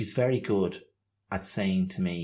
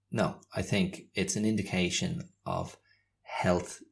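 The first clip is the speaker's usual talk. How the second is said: usual speaking voice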